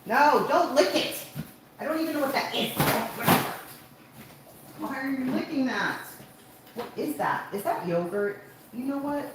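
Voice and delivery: high-pitched, alarmed scolding; real exasperated yell at a pet; yelling at dog. Real, not acted. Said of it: "I didn't mean to yell at you".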